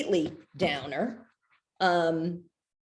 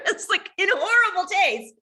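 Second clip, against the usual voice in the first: pitched up high through a laugh; 12 semitones higher, 4 dB louder than usual. Real, not acted. Admitting the joke was tasteless, laughing and pitched high. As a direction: laugh it out, much higher. Repeats phrase, second laughing.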